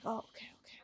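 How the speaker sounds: retold in a near whisper; barely voiced, very soft reply; hushed acceptance. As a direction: murmur a small, meek okay. Retelling mom's hushed acceptance.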